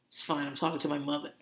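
suddenly low, soft and quick; quiet brush-off. Retold or imitated. Carmine excusing himself to etiquette expert, quiet mock aside. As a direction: drop into a hushed mock aside. Backs off after acted yell.

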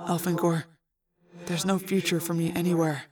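soft resigned reply. A narrator's reading. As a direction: say it quietly, giving up. Arbron resigned, refusing to leave.

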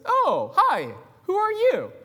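very high, bright put-on voice; high mock greeting. Skit stranger greeting the chicken in a high voice. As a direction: pitch way up, playing a curious stranger. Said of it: "one person just simply says".

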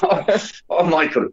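high, hammed-up Irish accent; bad Irish accent. Shown as a demonstration. Reviewer admitting he can't do an Irish accent, hammed-up bad Irish. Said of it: "I can't do an, an Irish accent".

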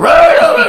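loud high rah rah rah; retelling the electrocuted man's yells, loud mock cries. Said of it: "he's down there going".

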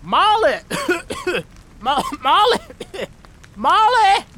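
very high, loud cries broken by coughs; choking yells. A narrator's reading. Jack searching the burning house for Molly, choking yells. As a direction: shout through smoke, coughing. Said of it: "choking on the sulfurous smog".

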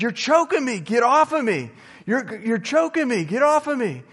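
high, loud mock-choking panic; hammed-up high panicked cries; imitated freak-out. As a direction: yell desperately like a friend being strangled. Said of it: "he's just freaking out".

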